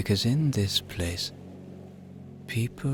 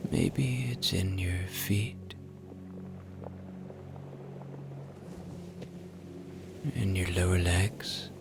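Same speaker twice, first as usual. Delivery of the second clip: guided relaxation; 8 dB quieter than usual. Acted. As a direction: ease them toward sleep, unhurried.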